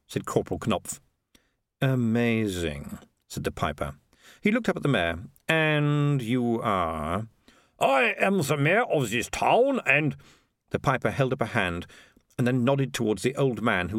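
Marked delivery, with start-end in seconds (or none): 1.8-3.0 one long, drawn-out word; disdainful piper. Acted. Piper judges the sausage, slowly disdainful. 5.5-7.3 extremely slow question; disdainful piper. Acted. Piper asks who the mayor is, slowly disdainful. 7.8-10.2 high, slow declaration; self-important mayor. Acted. Mayor introduces himself to piper, high and pompous.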